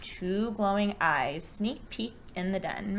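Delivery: ordinary delivery, nothing marked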